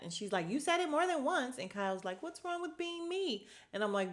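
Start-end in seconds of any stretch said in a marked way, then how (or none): 0.5-1.6 high-pitched mock accusation; imitating Dorit. Host mimicking Dorit accusing Garcelle, high mock voice. 2.2-3.5 much higher, slightly soft mock whine; a high mock-offended Kyle; imitating Kyle. Host mimicking Kyle taking offense, high mock whine.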